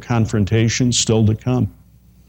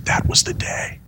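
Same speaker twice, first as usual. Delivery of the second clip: whisper; 5 dB quieter than usual. Retold or imitated. Retelling a quote, whispered.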